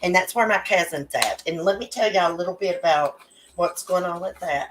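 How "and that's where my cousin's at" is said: ordinary delivery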